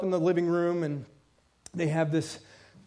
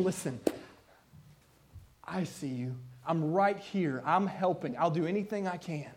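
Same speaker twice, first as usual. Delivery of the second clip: much softer, gentle comforting words; tender reassurance; 2 semitones lower, 5 dB quieter than usual. Retold or imitated. Church member running to comfort someone hurting, soft and tender. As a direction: reassure a hurting friend quietly. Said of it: "running to them and saying".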